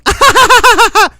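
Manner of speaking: loud burst of laughter; big high laugh. Real, not acted.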